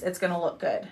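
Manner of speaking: ordinary talk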